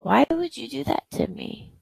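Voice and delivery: high-pitched, stunned disbelief; retold hurt disbelief. Wife asking her husband why he faked cancer, high and stunned. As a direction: pitch jumps up in shocked protest. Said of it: "And I'm like".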